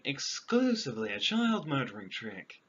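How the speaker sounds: usual speaking voice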